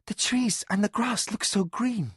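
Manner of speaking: put-on high, breathless young woman; Mara, breathless. A narrator's reading. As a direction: gasp it out in delight. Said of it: "Mara said breathlessly".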